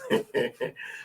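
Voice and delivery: short breathy laugh. Real, not acted. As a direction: chuckle under the breath. Laughing at Francis.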